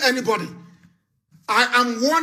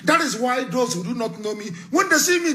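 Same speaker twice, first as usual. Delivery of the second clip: ordinary talk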